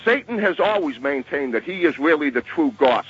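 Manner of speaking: ordinary talk, nothing marked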